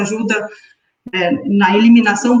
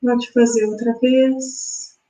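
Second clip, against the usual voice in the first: guided meditation. Acted. Guides breathing exercise.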